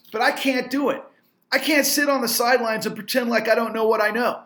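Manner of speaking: fed-up entrepreneur venting fury; furious rant. Real, not acted. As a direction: let the real anger climb. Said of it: "And I'm so furious".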